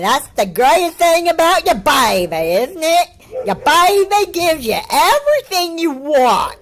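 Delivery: put-on high, loud syrupy baby talk; mocking imitation. Reactor imitating the kid praising his baby, mocking high voice. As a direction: parody him in an exaggerated high coo.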